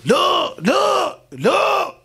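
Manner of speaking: mother grabbing the father, yelling no; mother's shout. Acted. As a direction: stop the father's hand. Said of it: "והאמא תופסת אותו".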